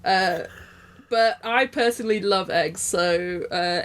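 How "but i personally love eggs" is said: ordinary talk, nothing marked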